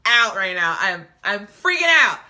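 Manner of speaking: high, loud, hammed-up panic; mock freak-out. Retelling the heroine's panic attack, theatrical freaking out. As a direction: shout it in a panic, easing off at the end. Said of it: "So she's freaking out".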